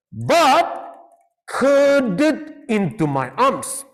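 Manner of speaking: slow, lingering storybook read; romantic reading. Shown as a demonstration. Teacher acting out the girl cuddling in, slow and tender. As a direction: savour each word like a love story. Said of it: "teacher reads romantic essay line".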